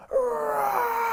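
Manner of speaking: very high cat roar; a boy-lion's high pretend roar; ferocious roar. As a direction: let out a high fierce roar. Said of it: "I let out a ferocious roar".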